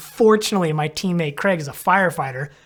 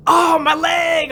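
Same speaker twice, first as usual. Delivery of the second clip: higher, louder pained cry, slowed; injured friend; 11 semitones higher, 5 dB louder, slower than usual. Retold or imitated. Injured rider noticing his broken leg, pained cry. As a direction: cry out about the leg in pain. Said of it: "then he was like".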